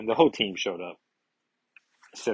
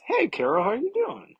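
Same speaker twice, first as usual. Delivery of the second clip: cheery greeting. Acted. Beast greets Carol, hammed-up cheery high voice. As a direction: swoop way up on how you doing.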